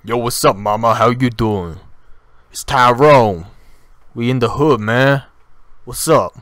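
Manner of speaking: booming, loud street-guy impression. Shown as a demonstration. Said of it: "as deep as possible".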